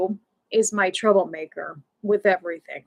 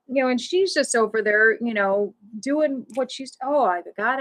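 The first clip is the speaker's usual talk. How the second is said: ordinary delivery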